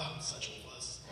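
breathy whisper to herself; whispered self-scolding. Retold or imitated. She whispers to herself after a pity party. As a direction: whisper it, ashamed of yourself. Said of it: "I look at her and I'm just like".

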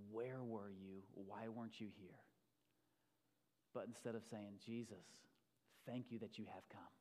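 low, soft, unhurried praying; quiet prayer. Real, not acted. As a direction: pray softly, with pauses between phrases. Leads a prayer.

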